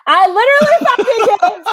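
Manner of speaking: high, loud laughing admission. Real, not acted. Caught out, laughing at herself.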